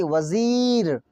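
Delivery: वज़ीर with a long, slow ee; drawn-out vowel. Shown as a demonstration. Teacher giving learners a ya maroof example, drawn out. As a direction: stretch the ee in वज़ीर. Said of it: "जो या खूब खींच कर पढ़ी जाए".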